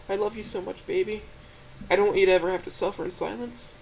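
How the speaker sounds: put-on a crying girlfriend pleading to help; high, gentle, tearful voice; tearful Stella. A narrator's reading. Stella's voice in the story.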